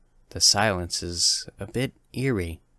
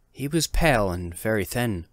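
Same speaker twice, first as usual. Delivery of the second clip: usual speaking voice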